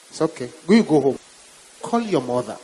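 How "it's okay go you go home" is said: ordinary delivery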